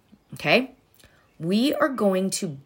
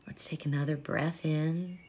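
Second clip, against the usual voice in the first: soft spa whisper; 2 semitones lower, 8 dB quieter than usual. Acted. Leads a body breathing meditation.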